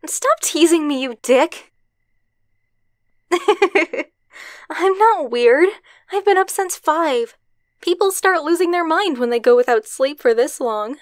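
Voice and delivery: a lover giddy and flustered after the confession. Acted. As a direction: babble happily, still buzzing.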